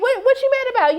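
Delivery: high, sassy comeback; sassy mock retort. Recapper mimicking a shady character, high and sassy. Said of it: "she was looking like".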